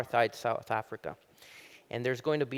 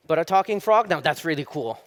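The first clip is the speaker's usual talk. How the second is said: louder, higher punch line; excited engineer; 3 semitones higher, 7 dB louder than usual. Acted. Engineer marvels at the talking frog, suddenly loud and excited. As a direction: land it with sudden delight.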